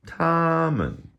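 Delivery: TOMMEN high on TOM, MEN dropping away; neutral-tone ending. Shown as a demonstration. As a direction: hold TOM high and loud, let MEN fall off toneless. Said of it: "falls off at the end".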